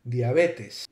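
diabetes said "dee-a-BEH-tess"; Latin pronunciation. Shown as a demonstration. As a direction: say diabetes the Latin way, with pure short vowels. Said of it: "In Latin, this would be pronounced".

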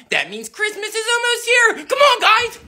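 ordinary delivery

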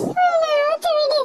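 put-on very high, slow wailing child voice; wailing plea. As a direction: stretch it out in desperate tears.

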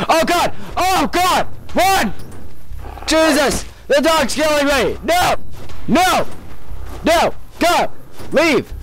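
high, frantic cries as the dog attacks; panicked shrieking. Real, not acted.

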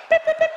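very high, loud electronic beep; mock beeping noise. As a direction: squeak it like a gadget switching on. Imitating a vibrator noise.

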